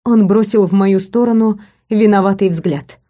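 plain reading voice

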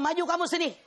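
a lecturer ordering a student to the front; stern professor. Retold or imitated. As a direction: summon the student up sharply. Professor calls student forward.

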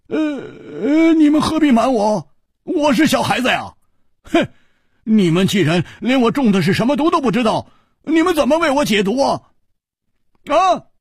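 a poisoned hero lashing out loudly; Hu Tiehua, bitter and bold. Acted. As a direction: accuse the friends of hiding the truth. Hu Tiehua's raised character voice.

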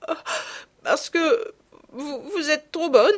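high, slow, choked with tears; a little girl gasping through sobs; sobbing child. Acted. Marguerite, choking on tears, tells her sisters they are too kind, sobbing high. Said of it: "qui suffoquait".

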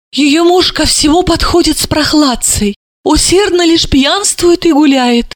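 high-pitched disparaging tirade; indignant complaint. A narrator's reading. Mother briefing the guest on her son-in-law, indignant.

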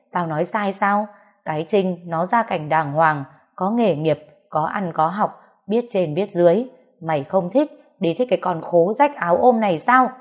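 ordinary delivery, nothing marked

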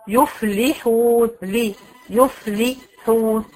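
يفلحون drawn out very slowly, each vowel full; teacher slowly recites يفلحون to her students; slow recitation. Shown as a demonstration. تلاوة بطيئة جدًا. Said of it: "عايزة كسرة تبان".

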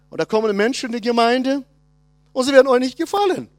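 ordinary talk, nothing marked

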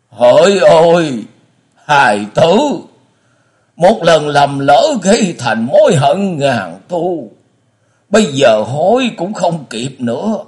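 high, slow wailing remorse; anguished lament. A narrator's reading. Blind father repents before his son, wailing slowly. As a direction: wail it out, drawn out and loud.

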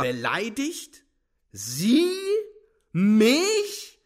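very high, drawn-out incredulous outrage; haughty, loud rebuff; scornful indignation. A narrator's reading.

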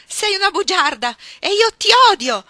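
very loud, high screamed fury; enraged howling. Acted. Iggy, out of her senses, at Ruth, enraged howl. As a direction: howl it, beside yourself with rage. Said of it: "cominciò a ululare in preda alla collera".

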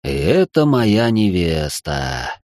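low, slow resigned nobleman; weary sigh. Acted. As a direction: sigh it out in a deep voice. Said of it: "вздохнул его сиятельство".

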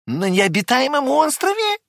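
surprised echo; amazed question. Acted. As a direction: echo the news in disbelief.

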